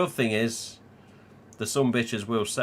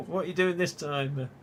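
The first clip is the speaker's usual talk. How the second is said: higher-pitched, laughing mock query; amused retold question; 6 semitones higher than usual. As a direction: ask it just after cracking up. Retold greeting amid laughter.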